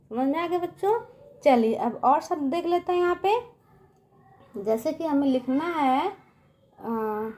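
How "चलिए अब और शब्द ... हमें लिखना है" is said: ordinary talk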